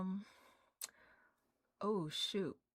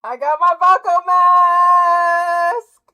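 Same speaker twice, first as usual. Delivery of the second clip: much higher, louder, slow excited shout; 9 semitones higher, 17 dB louder, much slower than usual. Real, not acted. Streamer showing viewers her new cosplay mask, shouting with delight. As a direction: drag it out in pure delight.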